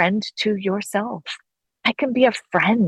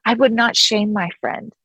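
ordinary talk

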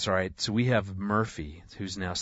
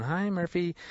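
passengers greeting; 8 semitones higher than usual. Retold or imitated.

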